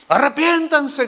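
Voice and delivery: grito contenido, agudo; grito de predicador. Retold or imitated. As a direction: llamado en alto, imitando al Bautista. (gritando, como pregonero)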